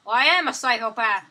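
ordinary delivery

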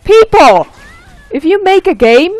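loud, high furious rant; real furious ranting; angry rant. Real, not acted. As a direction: yell at the game makers. Rant at game developers.

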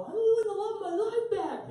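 high, loud re-enacted yell; drunken yelling. Running drunk across campus yelling at people, high and loud. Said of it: "I'm, like, yelling at people".

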